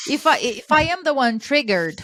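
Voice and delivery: ordinary talk, nothing marked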